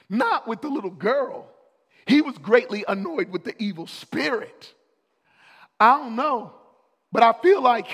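loud, high, driving preacher's cry; rally the room, pounding each somebody; full-force preaching. Acted. Preacher calling the church to get annoyed with darkness, loud and high.

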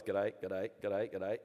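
repetitive run-together bushwalker greeting; rolled-into-one greeting. Retold or imitated. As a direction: run the greetings into each other. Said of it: "it rolls into one".

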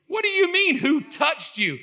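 high-pitched incredulous question, slowing at the end; acted bewildered protest; incredulous retort. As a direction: throw the question back in disbelief. Acts out Peter's disbelieving reply.

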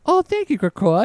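very high, sweet mock gratitude; grateful figure. Voicing Husk thanking Krakoa for shade, gushing. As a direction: gush thanks in a high put-on voice.

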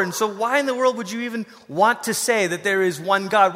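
ordinary delivery

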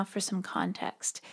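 hushed, breathy whisper to the listener; soft whispered aside before explaining. Real, not acted. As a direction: lean in and whisper it like a secret.

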